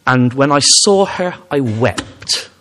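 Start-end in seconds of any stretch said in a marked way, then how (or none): none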